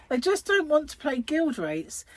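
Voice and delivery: normal talking voice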